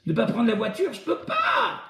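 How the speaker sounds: high, rising to a squealing shout; mocking whiny excuse. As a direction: parody a whiny excuse-maker, getting shriller. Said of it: "il y a quelqu'un qui me dit". (imitation moqueuse d'un râleur, aigu et criard)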